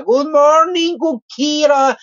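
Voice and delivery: high, sing-song good morning; cheery hello to a favorite viewer; bright greeting. Real, not acted. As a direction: greet a friend with delight.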